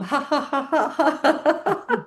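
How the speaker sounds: high ha ha while shaking; chilly laughter; shivering laugh. Shown as a demonstration. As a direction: laugh to warm up. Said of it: "shake the body a bit".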